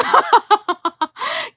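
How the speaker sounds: high burst of loud laughter. Real, not acted. Streamer cracking up at a chat comment, loud high laugh.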